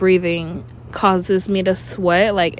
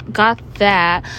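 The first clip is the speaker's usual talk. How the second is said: ordinary talk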